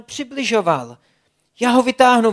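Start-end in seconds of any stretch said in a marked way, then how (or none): 1.6-2.3 higher, louder determined cry; shouting. Acted. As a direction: shout it out while pulling with all your might. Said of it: "vykřikl Blesk".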